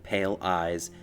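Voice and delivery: usual speaking voice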